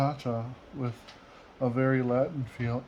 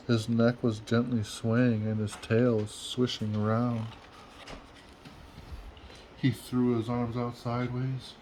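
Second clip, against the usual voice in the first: ordinary delivery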